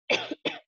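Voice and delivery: short dry cough; coughing fit. Real, not acted. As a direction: cough into the mic. Coughing mid-sermon.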